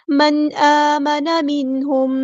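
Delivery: slow, drawn-out chanted recitation; tajweed chant; Quranic recitation. Shown as a demonstration. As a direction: recite it melodically, stretching each sound. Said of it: "recites Quran verse in tajweed".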